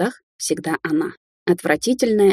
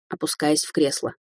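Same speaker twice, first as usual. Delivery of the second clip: plain reading voice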